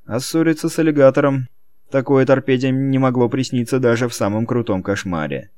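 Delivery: ordinary delivery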